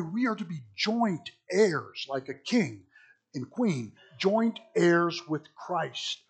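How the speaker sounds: usual speaking voice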